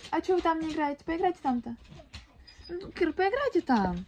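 ordinary talk, nothing marked

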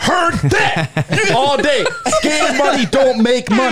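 laughing through the catchphrase, slow; slowly repeating it while cracking up. Real, not acted.

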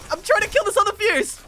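high, loud and urgent; frantic calling out. Real, not acted. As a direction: shout over the heat of a fight. Shouting to teammate during fight.

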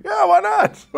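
very high, loud mock-eager exclamation; excited agreement. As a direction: blurt it out in comic, squealed enthusiasm. Acts out keen reply, laughing.

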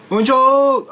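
loud, high drawn-out hello; loud greeting. Retold or imitated. Old man booming a hello as he walks in. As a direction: boom a hello into the barbershop. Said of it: "ってでっかい声で".

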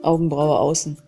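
ordinary talk, nothing marked

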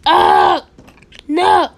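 very loud mock outcry; pretend anguished yell. Bad cats killed our homes, pretend anguished yell. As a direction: yell out in play horror. Cats' homes destroyed in play.

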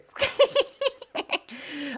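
high-pitched cackling giggle; uncontrolled high tittering; odd giggle fit. Real, not acted. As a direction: let out a silly high laugh. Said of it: "I don't know why I'm laughing like that".

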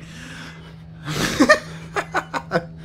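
high, airy burst of laughing; nervous laughter. Real, not acted. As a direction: laugh out at something creepy. Nervous laugh at creepy heads.

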